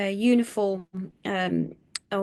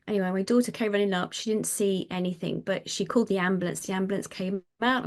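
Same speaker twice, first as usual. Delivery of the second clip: ordinary talk, nothing marked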